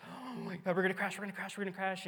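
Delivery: mock terrified wail; scared grandmother. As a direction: act out a grandma sure the plane will crash. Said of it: "She's over here scared".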